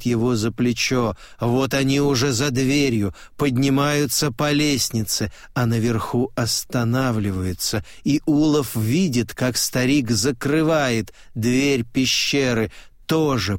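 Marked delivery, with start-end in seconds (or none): none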